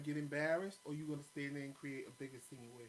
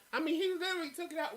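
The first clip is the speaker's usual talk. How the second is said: excited; 12 semitones higher, 9 dB louder, faster than usual. Real, not acted. Mocking husband's packing.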